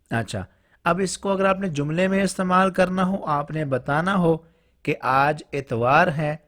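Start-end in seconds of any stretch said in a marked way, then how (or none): none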